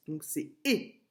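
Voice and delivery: ordinary talk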